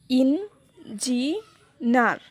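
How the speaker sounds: ইঞ্জিনার very slowly in three separate syllables, landing on নার; stress on last syllable. Shown as a demonstration. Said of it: "এই শেষের শব্দ নার".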